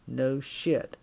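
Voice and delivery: lightly voiced tough guy; terse, understated "No shit"; longshoreman's low challenge. A narrator's reading. Longshoreman challenging a drunk woman, tersely menacing.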